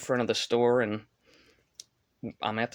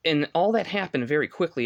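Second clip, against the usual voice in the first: higher and louder, still shaken by the near miss; agitated retelling; 6 semitones higher, 4 dB louder than usual. Real, not acted. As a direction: tell it like it just happened, heart racing. Retelling near head-on crash.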